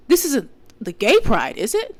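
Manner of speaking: a hint of a puzzled man asking; clueless man imitated. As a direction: lightly mimic a clueless stranger. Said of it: "And then he goes".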